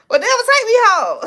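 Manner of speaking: high, loud, quick retort; hammed-up panicky comeback; mock alarm. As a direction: blurt it out as a comic plea to leave. Imagined answer to man claiming devilhood.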